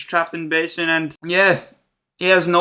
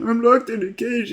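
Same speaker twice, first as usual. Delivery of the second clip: mock crying; 4 semitones higher than usual. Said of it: "Alison started crying about when".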